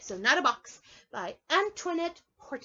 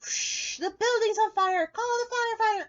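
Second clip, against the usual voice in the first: high, loud urgent commands; urgent firefighter play; 12 semitones higher, 8 dB louder than usual. Pretend. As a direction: shout orders at a blaze. Rabbit playing firefighter.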